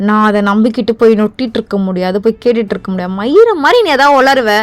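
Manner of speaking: angry shouting. Acted. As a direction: let the irritation boil over into yelling. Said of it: "கத்துனா மட்டும் கத்துனன்ற".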